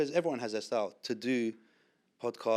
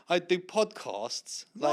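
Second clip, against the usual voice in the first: slow, drawn-out, higher mock announcement; can't keep straight face; 6 semitones higher, much slower than usual. Guest mocks introducing himself as a podcaster, slow self-mockery. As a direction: stretch it out as if it's absurd. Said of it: "I can't keep a straight face".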